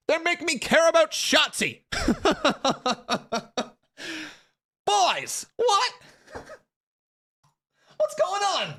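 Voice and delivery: high, incredulous, laughing disbelief; baffled that he suddenly cares; squeaky, giggling what-is-happening. Real, not acted. Stunned he cares about Shotzi.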